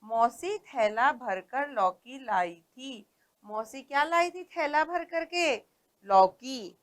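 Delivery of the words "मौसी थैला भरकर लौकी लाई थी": slow, word by word, au sounds clear; slow careful reading. Shown as a demonstration. Teacher reading story sentence to class, slow and clear. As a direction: read it slowly for the children. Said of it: "तो हम पढ़ते हैं अब".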